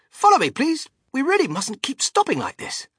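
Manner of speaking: high-pitched, hurrying call; urgent chivvying. A narrator's reading. Wonka telling visitors not to stop, urgent chivvying. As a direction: chivvy the group along, voice up high.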